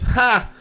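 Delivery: mock laugh. Retold doorman's laugh.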